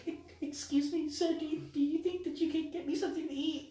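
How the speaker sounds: put-on high, soft old-lady voice; little old lady. Homeless old lady begging at their chair, high and soft. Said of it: "some little old lady".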